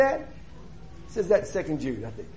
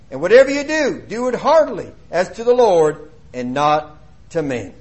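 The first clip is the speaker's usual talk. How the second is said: loud, measured Bible reading from the pulpit; scripture reading; 3 semitones lower, 5 dB louder than usual. Acted. Preacher reads Colossians to the church in a loud proclaiming voice. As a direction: proclaim each verse to the congregation.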